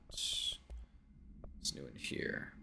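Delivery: soft whispered aside; whispering. Real, not acted. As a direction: breathe it out quietly.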